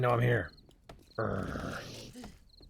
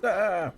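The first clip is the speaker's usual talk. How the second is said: startled cry; 11 semitones higher, 6 dB louder than usual. Real, not acted. Attacked by zombie.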